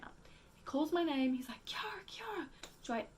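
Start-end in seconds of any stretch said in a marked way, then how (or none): 1.7-2.5 high, soft panicked calling; imitating a panicked call. Corey panicking calls her name.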